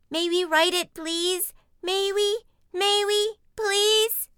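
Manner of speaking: put-on high, loud childish pleading; begging cubs. Cubs begging for a ride, high insistent pleading.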